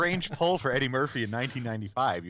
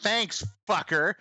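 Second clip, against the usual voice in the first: snarky thanks; 7 semitones higher, 4 dB louder, slower than usual. Retold or imitated. Lara Croft nabbing the diamond.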